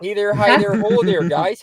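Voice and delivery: put-on Upper Peninsula accent. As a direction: greet the guys in a mock Yooper accent. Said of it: "up in the U P there".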